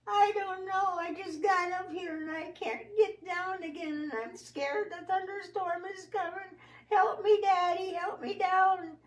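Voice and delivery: put-on very high, teary little-girl pleading; frightened child. Girl stuck in the tree pleads with daddy, high and teary. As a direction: beg daddy to get you down, scared.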